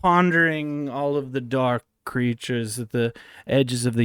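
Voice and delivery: ominous musing. Pretend. Pondering dark creatures for a friend, understated ominous murmur. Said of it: "I'm not seeing enough terror".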